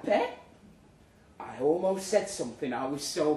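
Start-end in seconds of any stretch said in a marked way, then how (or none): 0.0-0.4 Geordie endearment. Retold or imitated. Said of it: "Caroline said".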